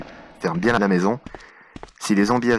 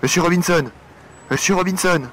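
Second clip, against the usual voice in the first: louder, higher urgent calls; calling out; 6 semitones higher, 3 dB louder, much faster than usual. Acted. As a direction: shout through the door. Calling at another door.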